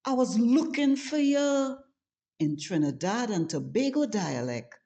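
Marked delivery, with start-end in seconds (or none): 0.0-1.8 higher, louder Trini lilt on "I was looking for you"; Trini accent. Shown as a demonstration. Showing tourists how Trinis say it, in a Trini accent. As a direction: sing it out like a true Trini. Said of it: "But we say".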